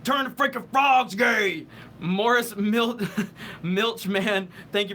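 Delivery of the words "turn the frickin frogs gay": loud ranting Alex Jones, higher; Alex Jones impression. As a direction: yell it like a conspiracy radio host. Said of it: "Alex Jones".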